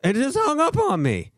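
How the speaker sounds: high, loud outraged squeal; indignant disbelief. Real, not acted. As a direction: voice shoots way up in shock. Call suddenly cut off.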